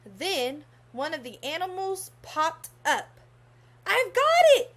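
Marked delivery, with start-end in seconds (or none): 3.9-4.7 high, loud cry of triumph; excited shout. Acted. Clark shouting as the animal pops up, excited. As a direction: shout it out, thrilled to catch it. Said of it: "said Clark".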